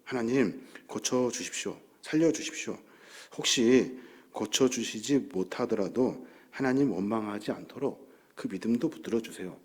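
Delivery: softly voiced, low petition; imitated humble prayer. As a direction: plead quietly with God, as if recalling a prayer. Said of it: "그런 마음으로 기도했던 적이 있어요".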